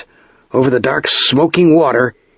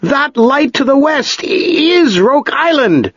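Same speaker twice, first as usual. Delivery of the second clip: much higher, slow urgent call; shouting up; slower than usual. A narrator's reading. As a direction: shout against the gale to the master. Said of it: "he shouted up to the master".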